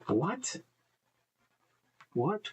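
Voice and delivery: high rising disbelief; startled double take on the word What; incredulous what. Real, not acted. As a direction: ask it in shocked disbelief. Reacting to a surprising claim.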